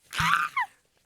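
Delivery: piercing high, loud squeal; excited squeal. Real, not acted. Fan thrilled about a shared concert, excited squeal. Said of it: "I'm so excited".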